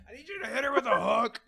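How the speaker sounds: high, loud, laughing joke demand; laughing mock demand.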